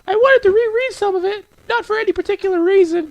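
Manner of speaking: put-on very high falsetto girl voice; defensive bookish girl, way up in falsetto; shy, evasive game girl. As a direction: explain it away in a squeaky-high voice.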